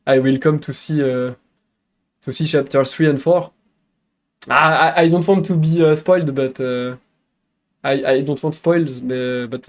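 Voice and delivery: English with a strong French accent; halting English in a French accent, filled with uh. Real, not acted. Streamer answering raiders in English, French accent. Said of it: "Le accent is cute".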